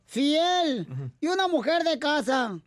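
drawn-out advice-column lady; Chela Prieto voice. Acted. S0 plays the Chela Prieto character.

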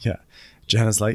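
ordinary delivery

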